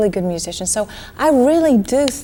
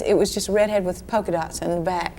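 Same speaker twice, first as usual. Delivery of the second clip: usual speaking voice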